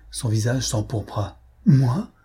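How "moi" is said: louder, higher-pitched question; shocked exclamation. A narrator's reading. Umilta realising she is accused, shocked cry. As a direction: exclaim in sudden disbelief. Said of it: "s'écria-t-elle".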